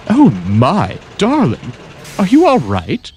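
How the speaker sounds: high fussing voice; concerned lady engine. Acted. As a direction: fuss over him with worry. Worried friend rushes over.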